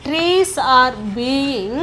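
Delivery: very slow, stretched words; slow dictation. Shown as a demonstration. Teacher building the passive sentence on trees, slow dictation. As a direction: spell the sentence out word by word.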